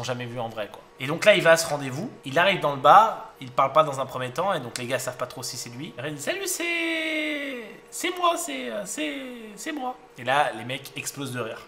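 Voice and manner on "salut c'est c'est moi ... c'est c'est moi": put-on very high, slow timid girlish voice; girly voice. Burly commander greeting strangers in the bar, high girlish voice. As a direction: squeak it out like a tiny girl's voice. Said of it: "il a une voix de meuf".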